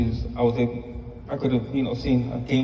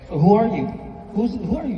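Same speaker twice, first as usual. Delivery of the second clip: higher, playful mock puzzlement; mock confusion; 9 semitones higher than usual. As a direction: pretend not to recognize him, teasing. Teasing son, pretending not to know.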